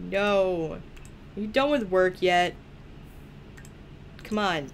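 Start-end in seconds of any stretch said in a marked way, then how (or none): none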